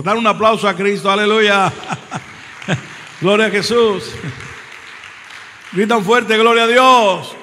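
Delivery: loud shouted praise calls; preacher shouting praise to the church; preaching at full force. Acted. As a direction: lead the crowd in loud praise. Preacher leads congregation's praise.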